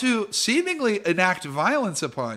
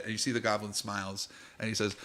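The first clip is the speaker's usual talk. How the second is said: normal talking voice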